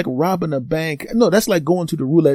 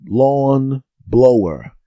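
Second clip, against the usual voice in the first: slow, drawn-out mock dread; stretched, wary disbelief; dismayed; 4 dB louder, much slower than usual. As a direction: let the name sink in with disgust. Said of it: "I'm going".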